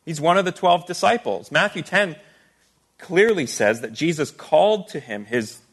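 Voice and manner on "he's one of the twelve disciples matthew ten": ordinary delivery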